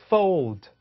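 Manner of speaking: fold drawn out slowly; teacher modelling fold slowly; slow model fold. Shown as a demonstration. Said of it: "따라해보세요".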